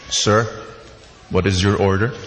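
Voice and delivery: a hint of a courteous waiter; polite waiter. Waiter voiced in story.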